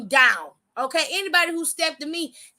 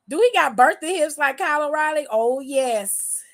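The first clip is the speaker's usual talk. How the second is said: ordinary delivery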